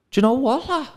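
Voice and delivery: put-on botched Scouse accent. Scouse lad turning on a protester, in botched Scouse accent. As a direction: attempt a Scouse lad and miss. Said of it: "Sorry, I fucked your accent there".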